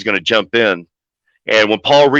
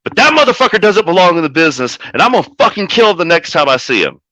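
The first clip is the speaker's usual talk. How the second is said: angry threat; 6 semitones higher than usual. Retold or imitated. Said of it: "I was like".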